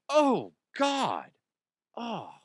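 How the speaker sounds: mild mock pain. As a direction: groan in moderate discomfort. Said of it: "really uncomfortable".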